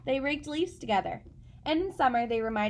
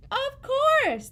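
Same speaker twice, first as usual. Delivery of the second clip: much higher and louder, proud; proud cat voice; 7 dB louder than usual. Acted. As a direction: answer with grand certainty. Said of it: "the cat replied".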